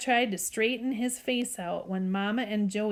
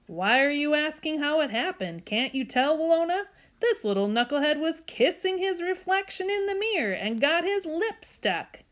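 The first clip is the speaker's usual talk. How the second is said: higher, incredulous dad voice; exasperated dad; 7 semitones higher than usual. Acted. Dad tells Willona what the knucklehead did, incredulous. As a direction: explain it in amused disbelief. Dad mocks the stuck boy.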